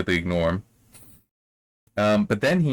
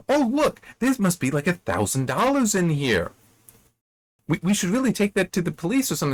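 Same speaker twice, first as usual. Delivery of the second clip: high-pitched mock amazement; hammed-up fake innocence, luring the listener in; con woman's feigned surprise; 9 semitones higher than usual. Con artist shows her mark the money, feigned surprise. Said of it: "the con is this woman pretends".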